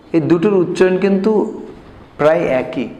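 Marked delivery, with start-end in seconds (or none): none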